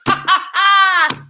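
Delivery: big hearty laugh. Acted. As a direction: laugh it up, loud and free. Brothers busting sides laughing.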